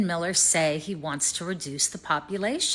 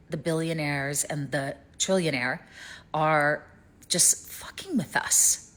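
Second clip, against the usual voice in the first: normal talking voice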